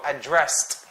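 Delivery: "addressed" with stress on DRESSED; verb stress. Shown as a demonstration. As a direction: slowly land on DRESSED in "addressed". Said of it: "this one is a verb".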